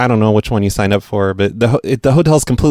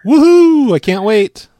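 high, loud mock whoop; hammed-up cheer; 12 semitones higher, 4 dB louder, slower than usual. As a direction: cheer like it's your own win. Prank caller cheering with victim.

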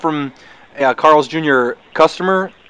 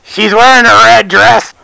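shouting; 7 semitones higher, 6 dB louder, slower than usual. Pretend. Shouting in background as parent.

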